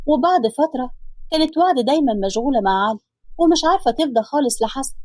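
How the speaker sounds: usual speaking voice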